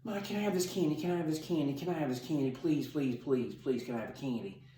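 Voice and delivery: put-on begging three-year-old. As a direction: plead repeatedly like a small child after candy. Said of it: "What's a three-year-old?".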